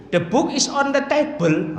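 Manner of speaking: high-pitched mock Jeporo accent in English; Jeporo-style English. Shown as a demonstration. Teacher mocks English Jeporo style, high regional accent. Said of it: "bahasa Inggris gaya, eh, gaya, eh, Jeporo itu".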